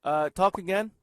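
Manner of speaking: nearly yelling a request. Real, not acted. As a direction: call it out too loudly for the mic. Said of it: "You don't have to yell".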